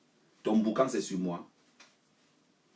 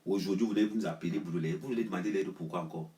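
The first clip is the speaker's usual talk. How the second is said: normal talking voice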